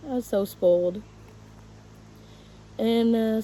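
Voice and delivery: ordinary talk, nothing marked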